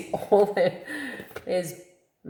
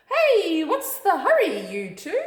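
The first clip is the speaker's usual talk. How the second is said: higher, louder, lightly voiced kangaroo mum; kangaroo mum calling; 10 semitones higher, 5 dB louder than usual. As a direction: call out to the running chicks. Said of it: "she asked".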